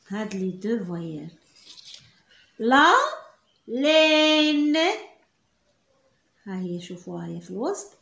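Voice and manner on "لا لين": "لين" stretched long, the vowel held; slow reading demo. Shown as a demonstration. Teacher to pupils, stretching the word slowly. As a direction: drag out "لين" so pupils hear the sound. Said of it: "كنقراوها".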